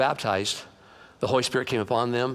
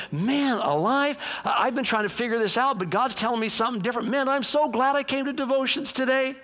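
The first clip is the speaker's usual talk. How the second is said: high-pitched acted gratitude; excited realization; 8 semitones higher than usual. Reader reacts to verse in devotions, excited realization. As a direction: exclaim it with delighted relief.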